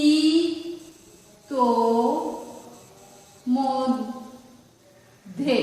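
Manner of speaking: ইতোমধ্যে in separate syllables with long pauses; syllable-by-syllable pronunciation. Shown as a demonstration. Teacher asking how it will be written, syllable by syllable. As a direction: dictate ইতোমধ্যে piece by piece, very slowly. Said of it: "তাহলে কি হবে?".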